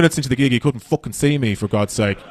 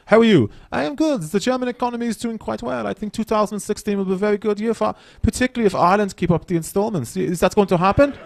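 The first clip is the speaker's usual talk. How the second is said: much higher, stiff mock German voice; mock German accent reporting on the economy; literal German; 8 semitones higher than usual. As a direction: answer like a painfully literal German. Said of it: "if you say to a German person".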